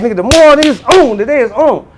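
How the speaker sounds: pumped about the day; hyped. Retold or imitated. Telling himself the day is on, high and pumped. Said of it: "I'm lit".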